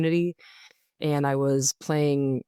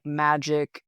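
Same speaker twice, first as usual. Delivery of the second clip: ordinary talk, nothing marked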